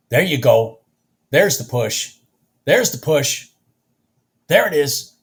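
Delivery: root for the chart like a fan; real excited egging on; excited cheering. Real, not acted. Cheering on a live trade.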